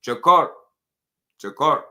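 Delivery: loud, high mock Cuban-accent call-out; comic Cuban accent, each call separate and rising. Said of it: "Y'all know I just be clowning with the accents".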